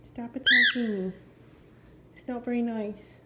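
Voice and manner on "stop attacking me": ordinary talk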